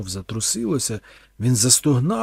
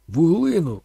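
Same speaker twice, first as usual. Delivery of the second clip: loud, higher shouted order; 6 semitones higher, 8 dB louder than usual. A narrator's reading. Thorn shouts to give the priest charcoal. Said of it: "крикнув він".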